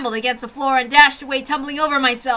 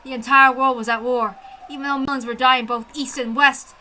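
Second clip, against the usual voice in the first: ordinary delivery, nothing marked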